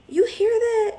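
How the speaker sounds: high, loud startled question; alarmed question. Retold or imitated. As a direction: suddenly ask in alarm. Alarmed question about car noise.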